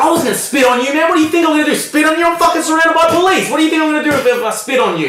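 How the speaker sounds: high, loud mocking disbelief; retold indignant retort. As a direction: throw it back at the cop, voice way up. Prisoner mocking cop's accusation.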